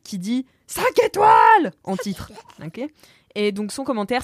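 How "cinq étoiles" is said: high, slow exclamation of five stars; excited title read. Acted. Host reading a listener's review title, cheering.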